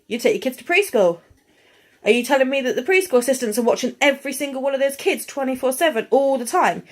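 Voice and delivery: normal talking voice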